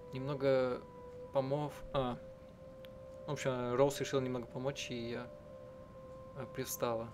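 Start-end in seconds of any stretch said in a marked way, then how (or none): none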